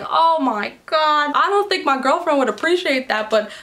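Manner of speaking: exaggerated mock Australian accent. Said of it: "in his Australian accent".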